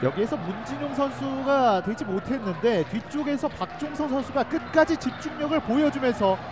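normal talking voice

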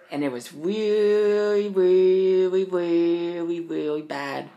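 drawn-out, loud, slow stress on each "really"; emphatic. Real, not acted. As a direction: lean hard into every "really".

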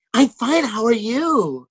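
chirpy high greeting, then drawn out; mock the fake-nice extras; fake cheerful.

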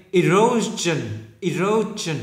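"erosion" starting on a clear "i"; slow teacher's model of "erosion"; âm đầu i. Shown as a demonstration. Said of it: "Đâu là i". ("erosion" mở đầu bằng âm "i")

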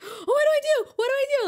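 very high, loud frantic questioning; imitated panicked yelling. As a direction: shriek it like a panicking kid brother. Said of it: "but he was yelling also".

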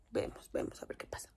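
softly whispered, voiceless, trailing off; whispered aside. Real, not acted. To her viewers, unsure of plans, whispered. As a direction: let it fade into a whisper.

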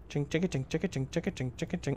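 soft rhythmic chinking noises; imitated clinking. Player making noises while moving along, softly rhythmic. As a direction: patter it quietly like a little machine.

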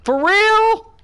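high, real disbelief, slowing on what the fuck; exasperated disbelief. Real, not acted. Streamer to his useless team, high pitched disbelief. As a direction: voice jumps up in exasperated outrage. Teammates failing in match.

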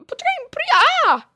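high, loud cries for her to wait; panicked yelling. Acted. Girl yelling to Priya that she can't catch. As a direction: yell it, desperate to keep up.

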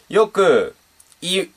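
ordinary delivery

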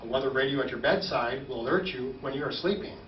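ordinary talk, nothing marked

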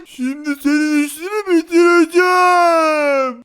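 slow, drawn-out angry threat; hammed-up menace; menacing threat. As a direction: threaten slowly, swelling on the last word. [name] threatens the prankster.

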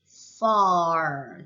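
far stretched out with a curled r ending; blended English sounds. Shown as a demonstration. Teacher blending F with A R, long drawn-out far. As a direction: blow the f, then hold the r in far. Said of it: "그 다음 A R".

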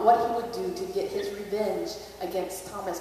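ordinary delivery

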